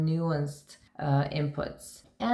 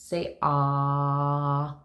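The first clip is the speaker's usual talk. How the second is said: doctor's ah. Retold or imitated. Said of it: "our doctor says".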